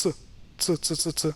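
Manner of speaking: "T" as a quick fused "ts", repeated; rapid repeated ts for "T"; single ts sound. Shown as a demonstration. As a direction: tick out "T" as short "tsuh" sounds. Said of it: "one letter that has also one sound".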